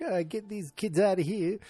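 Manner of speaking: high-pitched put-on grouch; Archie Bunker. Host as husband dismissing the young carolers, mock-grumpy impression. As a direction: grumble in a mock-irritated voice. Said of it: "He's like".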